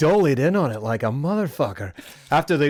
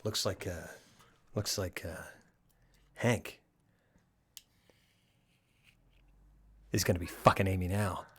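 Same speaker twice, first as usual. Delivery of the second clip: mock CSI one-liner; Caruso one-liner impression; 10 semitones lower, 13 dB quieter than usual. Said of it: "Can I do one?".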